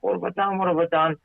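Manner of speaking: high, thin-volume panicked self-talk; retold panic. Speaker replays his frantic thoughts mid-trip, panicked.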